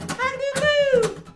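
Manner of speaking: high loud rooster crow. Shown as a demonstration. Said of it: "the magic word".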